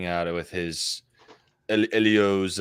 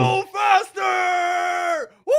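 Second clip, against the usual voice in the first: very high, long-drawn race yell; real high-pitched urging shriek; frantic cheering scream; 3 dB louder, much slower than usual. Real, not acted. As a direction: scream them on, stretching the words out. Cheering on a racer mid-race.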